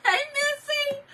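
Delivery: high, loud hello through laughter; laughing greeting. Real, not acted. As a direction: call out while still cracking up. Greets viewer amid hard laughter.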